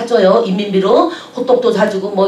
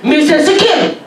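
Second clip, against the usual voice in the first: mock guide; 4 semitones higher than usual. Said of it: "이러는 거예요".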